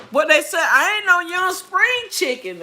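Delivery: high, loud teasing protest; playful protest. Real, not acted. Joking about her age.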